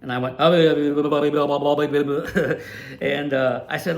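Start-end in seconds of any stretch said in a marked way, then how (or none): 0.4-3.0 a giddy stammer of nonsense sounds; tongue-tied laughing babble, caught off guard; flustered babbling laugh. Retold or imitated. Tongue-tied fan reacts when the track owner answers, laughing babble.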